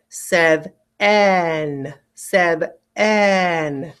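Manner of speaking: sev-en with a full eh in the second syllable; spelling pronunciation. Shown as a demonstration. Teacher spelling seven by sound. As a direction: say sev-en in two clear halves. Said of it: "I want you to say to spell".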